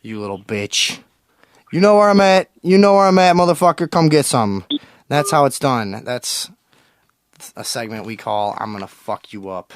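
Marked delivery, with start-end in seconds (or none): 0.0-4.6 loud, much higher yelling taunt; mock tough-guy finale; hammed-up angry shout. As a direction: taunt the caller to come get some. Mock radio feud with caller.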